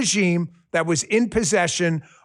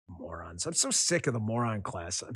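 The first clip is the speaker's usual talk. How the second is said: much softer under-breath word; disgusted mutter, much softer; 11 semitones lower, 12 dB quieter than usual. Real, not acted. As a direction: mutter it to yourself. Muttered aside after rant.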